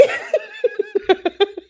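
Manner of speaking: real high laugh; burst of laughter. Real, not acted. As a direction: break into laughter. Laughing at Celie.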